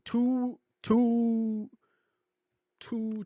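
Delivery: high, held-out silly note; playful high hoot. Pretend. Telling how many nights it takes, playful high hooting. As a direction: stretch the word TWO way up high. Playful drawn-out repeated number.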